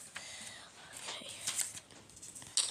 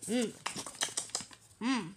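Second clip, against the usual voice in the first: normal talking voice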